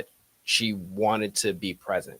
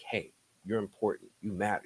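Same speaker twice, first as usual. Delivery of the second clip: gentle reassurance; 9 dB quieter than usual. Retold or imitated. Teacher's affirming words to son.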